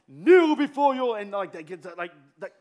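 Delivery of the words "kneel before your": booming, slow command; movie king command. Retold or imitated. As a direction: roar it like a movie tyrant. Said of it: "those movies where they're like".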